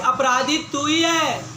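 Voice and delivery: loud, high storyteller's accusation; angry accusation. Acted. Hunter blames the snake for the death, acted anger. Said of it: "एकदम क्रोधित होकर के वो बोला".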